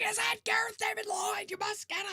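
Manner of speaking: fangirl squeal. Retold or imitated. Retelling her fangirl freakout.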